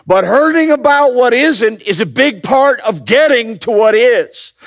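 loud, high impassioned preaching; preaching at full force. Acted. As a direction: build the sermon to a roar.

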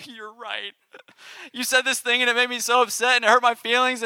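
high-pitched, hammed-up hurt; mock emotional outburst. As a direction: burst out high, a comic wounded confession. Acts out finally admitting hurt feelings.

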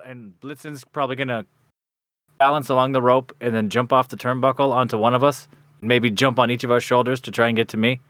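ordinary talk, nothing marked

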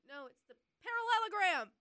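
high, loud burst of excitement; excited. Real, not acted. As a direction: blurt out the answer thrilled. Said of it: "I need somebody else to be as excited".

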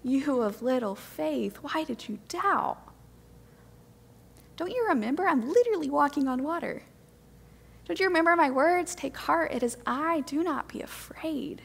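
soft, tender, reassuring Jesus; soft kind voice, fading to a whisper; kind and gentle. Retold or imitated. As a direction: gently comfort a frightened friend. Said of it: "I believe that his tone was".

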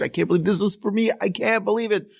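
thrilled nephew, pitch jumping up; excited. Retold or imitated. Nephew thanking his uncle for the car, excited. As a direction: gush with disbelief over the gift. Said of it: "So excited".

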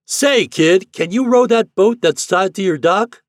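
high, loud megaphone holler; hearty long-distance hail; captain hailing. A narrator's reading. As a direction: shout a question across the river. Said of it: "raised his trumpet again".

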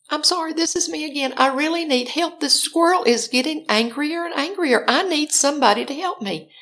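high-pitched desperate appeal; urgent pleading. Retold or imitated. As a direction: beg the police, insistent and worked up. Pleading with police again.

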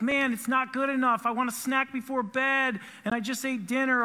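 lightly voiced whiny child complaining; mock whiny kid. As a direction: whine it like a kid who wants a snack. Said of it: "They literally cry about everything".

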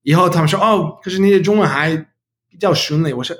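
American-accented learner Mandarin; careful second-language Mandarin, English accent; foreigner speaking mandarin. Real, not acted. Non-native learner speaking Mandarin throughout.

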